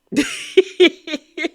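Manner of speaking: high, squealed mock giggle; mocking laugh. Imitating Brittany watching the fight, mocking laugh. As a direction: cackle like the smug onlooker.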